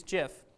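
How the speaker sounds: "gif" like Jif peanut butter; soft-g pronunciation. Shown as a demonstration. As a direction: say "gif" as "jiff". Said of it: "be pronounced like the peanut butter".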